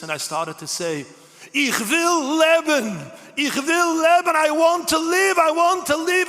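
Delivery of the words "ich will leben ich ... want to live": high, loud cry to live; screaming. Retold or imitated. Prisoner screaming to his mentor that he wants to live. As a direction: scream your will to live, slow at first. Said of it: "And I was screaming".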